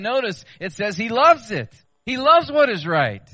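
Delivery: loves said high and loud; stress on loves. Real, not acted. Preacher emphasising to congregation that God loves right, loud. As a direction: press hard on the word loves.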